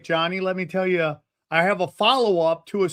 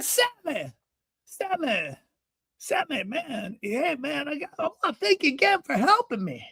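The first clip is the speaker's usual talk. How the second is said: put-on high old-man voice, softer calls; grateful older man; 9 semitones higher, 4 dB quieter than usual. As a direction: coax his pal in a high voice. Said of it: "It's how he talks".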